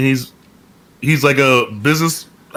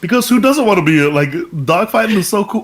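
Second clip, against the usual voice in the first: ordinary talk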